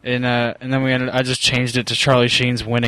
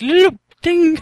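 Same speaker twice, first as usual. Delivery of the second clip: squeaky excited mimic; 6 dB louder, slower than usual. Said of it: "You're like".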